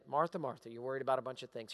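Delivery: soft, low, gentle rebuke; calm Jesus. Retold or imitated. Jesus calmly answers the upset Martha. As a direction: settle her down softly. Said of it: "Jesus is gonna calmly say".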